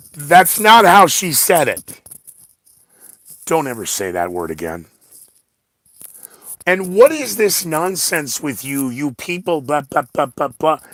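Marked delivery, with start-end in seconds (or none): 0.1-2.0 mock movie yell. Quoting a movie line, loud. 6.7-10.8 exasperated, higher mocking voice; mock their babbling with scorn; sneering blah blah rant. Mocking people's chatter.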